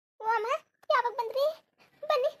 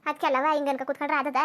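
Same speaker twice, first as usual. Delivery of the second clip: ordinary talk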